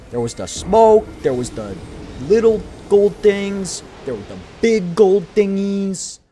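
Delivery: excited. Real, not acted. Excitedly recounting the attack.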